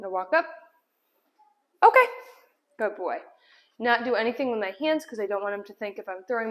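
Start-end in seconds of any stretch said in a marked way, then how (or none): none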